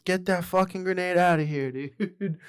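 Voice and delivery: mock urgent yell at a buddy; retold yell. Yelling to warn college buddies at the bar, mock yell. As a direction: call it out loudly, imitating old college antics. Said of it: "Just yelling at them".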